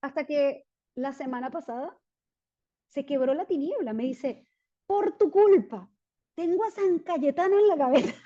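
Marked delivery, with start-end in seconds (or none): 4.9-8.1 high, loud mock accusation; hammed-up mock blame, played for laughs; playful quote. A man blaming the preacher for his saint, mock accusing. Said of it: "se los cuento con mucha gracia".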